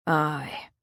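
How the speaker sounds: a quiet sorrowful aye; said sadly. Acted. Old Willie confirming the bad news, low and sad. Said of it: "old Willie said sadly".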